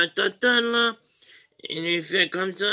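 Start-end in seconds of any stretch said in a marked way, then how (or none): none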